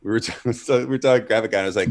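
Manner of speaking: ordinary talk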